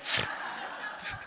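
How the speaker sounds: quiet, unvoiced chuckle; breathy laugh. Real, not acted. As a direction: laugh under your breath.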